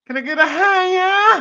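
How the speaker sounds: squeaky high Bigfoot call. Acted. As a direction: shriek it out way up high. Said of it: "See, for me, it's just".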